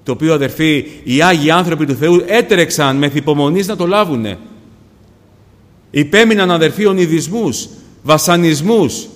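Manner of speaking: loud, rousing sermon delivery; impassioned pulpit voice; forceful preaching. Real, not acted.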